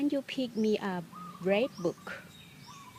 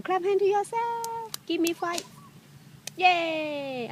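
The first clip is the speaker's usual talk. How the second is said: cheering; 8 semitones higher, 4 dB louder than usual. Real, not acted. Praising child loudly.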